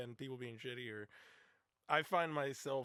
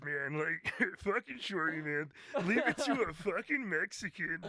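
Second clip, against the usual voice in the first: higher, louder, slow buddy voice; imitating Joe; 5 semitones higher, 6 dB louder than usual. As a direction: do your friend's voice, slow and loud. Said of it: "Does that not sound like him?".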